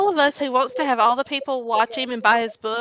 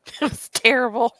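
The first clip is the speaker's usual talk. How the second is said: laughing. Real, not acted. Laughing while giving verdict.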